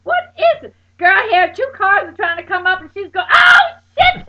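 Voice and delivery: high, loud panicked screams and crying; panicked screaming. Retold or imitated. Friend reporting the car down the wrong way, panicked screaming. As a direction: shriek in terror, near tears.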